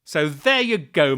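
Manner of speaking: ordinary delivery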